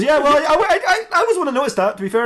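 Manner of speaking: very high, stammering excuse; flustered defensiveness. Acted. Young detective excusing a missed clue, flustered.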